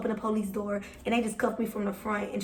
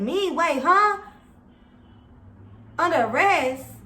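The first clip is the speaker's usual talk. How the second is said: higher, louder shocked questions; floored by the arrest; retold shock at the arrest; stunned disbelief; 6 semitones higher, 4 dB louder, slower than usual. Told she is under arrest.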